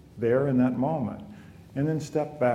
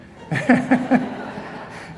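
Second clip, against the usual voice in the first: breathy, high mocking laugh; 12 semitones higher, 4 dB louder than usual. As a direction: laugh along with the dazzled students. Mocking students' delight.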